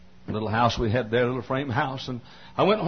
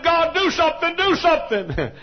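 much higher and louder, desperate plea; crying out to God; 6 dB louder than usual. Retold or imitated. Preacher retelling his prayer to God, crying out loudly. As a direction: cry out to heaven.

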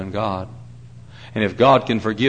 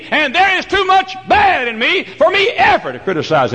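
fervent shouting preacher climbing higher; preaching at full force; 10 semitones higher than usual. Real, not acted. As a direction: call out the congregation section by section.

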